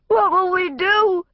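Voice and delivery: high wailing plea; sobbing wildly. A narrator's reading. Sister sobbing to family beside burning car, high wail. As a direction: cry it out in despair. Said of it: "Evelyn sobbed wildly".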